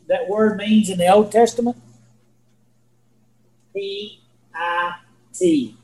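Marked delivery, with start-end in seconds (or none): 3.8-5.8 very slow, one letter at a time; spelled out slowly. Shown as a demonstration. Preacher revealing what Sheol means, P I T spelled out slowly. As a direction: draw out P, I and T separately.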